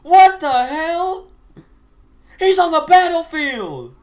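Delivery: loud, stretched disbelief; shocked shout. Real, not acted. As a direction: yell it in total shock. Shocked at soldier caller.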